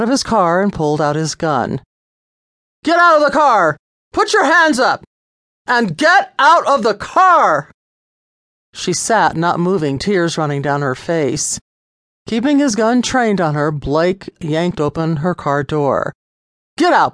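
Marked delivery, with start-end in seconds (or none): 2.8-7.7 much higher, louder barked commands; police shout. A narrator's reading. Officer yelling at the driver to get out, loud commands. 16.8-17.1 higher, louder snap of an order; sharp command. A narrator's reading. Officer ordering Lisa out, loud bark. Officer yanking open car door.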